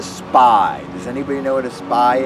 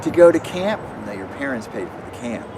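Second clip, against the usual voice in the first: put-on children's magic show patter; 4 semitones higher than usual.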